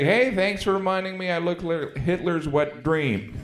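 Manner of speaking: usual speaking voice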